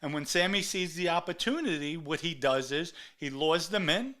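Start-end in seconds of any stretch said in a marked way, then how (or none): none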